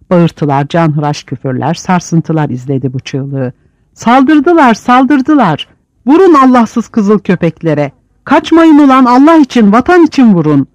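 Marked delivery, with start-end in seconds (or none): 3.9-10.7 high, loud battle cries; panicked yells. Acted. A prisoner yells over the riot, high and panicked. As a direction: scream the alarm over the brawl.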